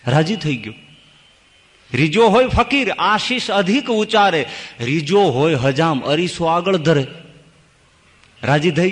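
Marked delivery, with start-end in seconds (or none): 1.9-7.1 raised, ringing recital that settles by the second line; verse recitation. Acted. Preacher returns to the verse of fakir and barber, reciting. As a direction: declaim the couplet in metrical phrases.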